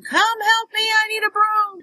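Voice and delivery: high sustained call for help; mock yell. Daughter yells for Mom to bring a broom, high mock yell. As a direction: holler in a high voice, without real volume. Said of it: "I'm yelling for Mom".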